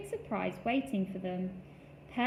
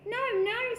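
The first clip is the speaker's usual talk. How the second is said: high badger voice; 13 semitones higher, 5 dB louder than usual. A narrator's reading. Badger disagreeing.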